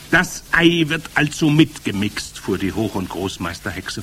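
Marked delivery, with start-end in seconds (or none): 0.1-2.4 high, loud witch voice; commanding Grand High Witch. A narrator's reading. Witch leader starting to explain her recipe, commanding. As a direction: open grandly, pitched way up.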